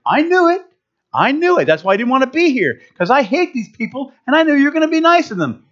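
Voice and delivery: high, fast sulky complaint; whiny Jonah. Retold or imitated. Prophet Jonah complaining to God, high and whiny. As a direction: whine it like a sulking prophet. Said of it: "My favorite thing he says".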